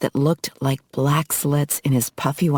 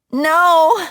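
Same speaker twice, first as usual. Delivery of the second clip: high piercing scream of protest; 13 semitones higher, 6 dB louder than usual. A narrator's reading. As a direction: scream it out, desperate. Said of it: "Emma screamed".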